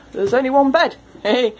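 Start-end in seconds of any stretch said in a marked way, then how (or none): none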